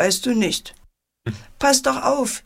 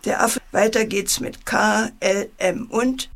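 ordinary delivery

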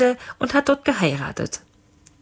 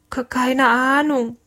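higher, slow, scared stammer; frightened stutter pitched up; 7 semitones higher, slower than usual. A narrator's reading. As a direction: stumble on the first sound, afraid of the captain. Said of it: "stotterte der Kurze".

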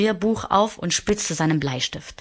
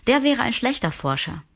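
put-on higher boyish voice, wry; little prince; 8 semitones higher than usual. A narrator's reading. Little prince jokes about a drunkard he knows, higher and wry. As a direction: tease it lightly, pitched up.

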